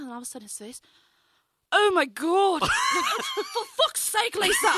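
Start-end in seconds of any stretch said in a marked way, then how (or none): 1.7-2.6 high mock shout of horror; loud, scandalized outburst; horrified Englishman. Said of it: "it's just this horrified man". 2.7-4.7 very high, loud, drawn-out shriek; hammed-up English horror; horrified Englishman. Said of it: "it's just this horrified man".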